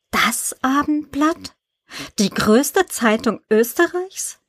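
open-mouthed amazement. A narrator's reading. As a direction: start slow, lifting in disbelief. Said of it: "wie ihr Mund offen hing".

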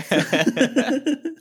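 high delighted giggle. Real, not acted. Podcaster giggling at the drama, high and delighted. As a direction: let a bright giggle escape. Gleeful laugh after inner yes.

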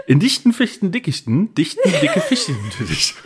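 tongue twister breaking into laughter; laughing through it. Shown as a demonstration. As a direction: giggle through the last try. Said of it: "Sage dreimal hintereinander".

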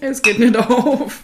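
slow, strained, high and loud; straining against a stuck cap; opening a stuck jar. Real, not acted. Bottle won't open.

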